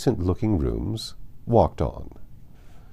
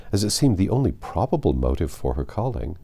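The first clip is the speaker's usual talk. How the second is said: ordinary delivery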